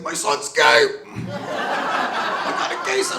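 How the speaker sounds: high mock outraged dad, slow and breathy; gruff dad. Dad reacting to meditation advice, mock outrage. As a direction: ham up a scandalized father. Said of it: "He's like".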